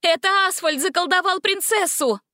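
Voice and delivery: high, pressing accusation; high urgent accusation; urgent shout. A narrator's reading. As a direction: make them listen. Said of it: "крикнула Гретель".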